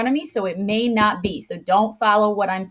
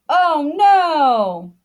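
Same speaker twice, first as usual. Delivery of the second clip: loud, higher cry of dismay; strong-emotion exclamation; 5 semitones higher, 5 dB louder than usual. Shown as a demonstration. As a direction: yell it out, long falling NO. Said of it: "might yell or you might say with excitement".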